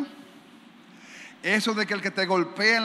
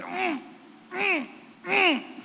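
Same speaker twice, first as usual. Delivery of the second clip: suffering hum; 11 semitones higher, much slower than usual. Shown as a demonstration. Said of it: "quedarnos callados, sufriendo".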